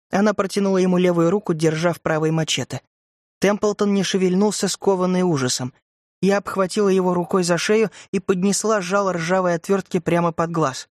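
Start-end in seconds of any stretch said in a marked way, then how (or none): none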